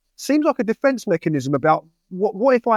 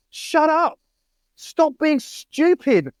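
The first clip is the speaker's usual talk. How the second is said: high, impatient telling-off; pitched-up, fed-up scolding; exasperated scolding; 8 semitones higher, much slower than usual. Retold or imitated. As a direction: snap them out of their dithering. Telling off indecisive young men.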